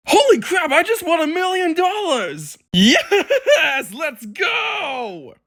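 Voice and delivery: very high, squealing victory cry; ecstatic celebration. Acted. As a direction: shriek with acted joy at winning. Driver celebrates winning a million.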